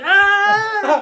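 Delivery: high, loud scream of pain; hammed-up pained shriek; mock scream. Wounded man as a heel twists in his leg, mock screaming. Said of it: "he's screaming".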